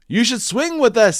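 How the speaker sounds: playful monkey call; monkeys' voice. Acted. Monkeys inviting Jim to swing, high and loud. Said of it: "said the monkeys".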